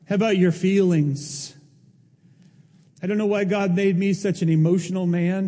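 ordinary talk

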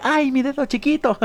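playful, high-pitched "ouch" example; hammed-up yelp of pain; mock pain. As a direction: cry out as if hurting a little toe, in jest. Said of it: "hasta dolor de".

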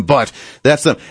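usual speaking voice

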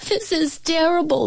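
high wailing cook; despairing cry, pitched well up; cook's anguished high wail; cried out. A narrator's reading. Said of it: "he cried".

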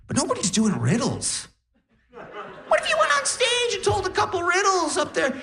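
very high, squeaky pitch of an idea; excited mom impression. Mom suggesting riddles for the comedy act, squeaky excited imitation. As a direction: imitate a mom climbing into falsetto enthusiasm.

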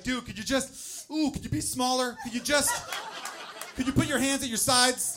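father coaching his newborn out, loud and pleading; coaching the baby. Retold or imitated. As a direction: coax him out like a coach. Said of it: "coaching him on the way out".

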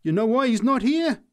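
high and loud, crowing the answer; a preacher trumping a heckler; triumphant. Acted. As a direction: throw it back like a winning card. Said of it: "she answered triumphantly".

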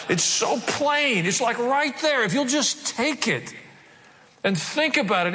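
high, slow, emphatic preacher's cry; fervent sermon climax; preaching at full force. Acted. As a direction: drive it home from the pulpit.

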